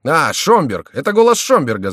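high, loud cry of recognition; excited shouting. A narrator's reading. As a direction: call it out high and eager. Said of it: "закричали миньоны".

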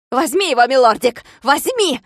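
high, loud gleeful urging of the dog; gleeful squeal. A narrator's reading. Klops cheering his dog on, gleeful squeal. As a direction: squeal it out with childish delight. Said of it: "радостно завизжал Клопс".

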